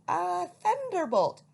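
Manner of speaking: loud slow high startled cry. A narrator's reading. Old lady startled by owl landing, loud cry. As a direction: cry out in shock. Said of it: "cried the old lady".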